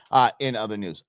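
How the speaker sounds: ordinary talk, nothing marked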